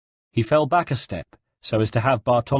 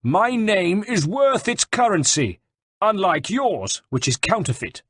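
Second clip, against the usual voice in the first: high, slow, indignant retort; raised voice; 10 semitones higher than usual. A narrator's reading. Captive general retorts to de Valois, voice raised. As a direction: throw the insult back at him. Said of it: "raising his voice".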